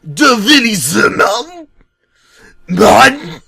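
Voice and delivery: high, loud fake German shout; mock German rant. Bob raving in mock German, with a loud high shout. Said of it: "like a raving".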